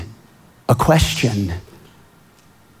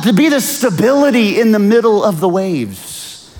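soaring higher, ringing preacher voice; preaching at full force; 12 semitones higher than usual. Acted. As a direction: climb into a fervent preaching peak. Preaching climax.

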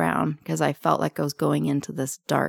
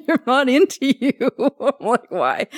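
laughing; 7 semitones higher, 6 dB louder than usual. Real, not acted. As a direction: talk through real laughter, voice jumping high. Laughing while retelling her refusal.

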